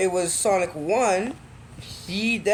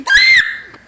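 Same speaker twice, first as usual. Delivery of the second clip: very high, piercing mock shriek; imitated loud scream; 13 dB louder than usual. Replicating the scream from the speakers, piercing shriek. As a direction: let out a sudden shrill scream. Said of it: "A loud scream plays out".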